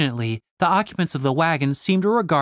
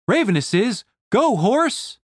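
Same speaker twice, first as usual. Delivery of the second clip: much higher shout to the horse; urging cry; 7 semitones higher than usual. A narrator's reading. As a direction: spur the horse on. Julian spurs horse.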